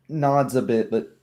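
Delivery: normal talking voice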